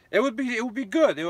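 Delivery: high, bright burst of enthusiasm; suddenly thrilled, pitch jumping up; excited. Real, not acted. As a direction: gush about how good it would be. Daydreaming about his podcast.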